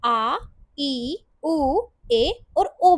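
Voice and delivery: आ, ई, ऊ, ए, ओ said slowly and clearly as Hindi vowels; teacher drawing out आ ई ऊ ए ओ for beginners; slow vowel demo. Shown as a demonstration. Teacher to beginners, slowly modelling आ ई ऊ ए ओ. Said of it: "pronunciation आप इसकी हिंदी की तरह करोगे".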